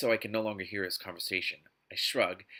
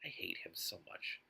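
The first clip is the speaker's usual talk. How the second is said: toneless whisper, much softer; whispered aside; 6 semitones lower, 13 dB quieter than usual. Real, not acted. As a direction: breathe it out as a secret grudge.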